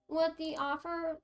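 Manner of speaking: ordinary delivery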